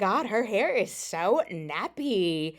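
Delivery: mock catty voice, ending high; mocking gossip. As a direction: ham up a gossiping girl. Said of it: "Let me stop".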